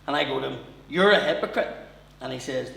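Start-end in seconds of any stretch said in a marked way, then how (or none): 0.9-1.7 louder accusing; mock accusation. Son accusing drunk dad of hypocrisy, loud. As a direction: throw it at him.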